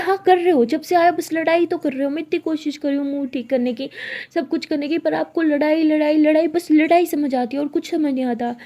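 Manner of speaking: loud, exasperated scolding, hammering the word लड़ाई; angry outburst. Acted. Siya yells at Tara for always fighting, loud and exasperated. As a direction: vent the frustration hard at her partner. Girlfriends' heated argument in car.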